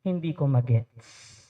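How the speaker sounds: low, soft inquiry; calm asking. Shown as a demonstration. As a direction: ask to understand gently. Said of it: "pwede mong sabihin".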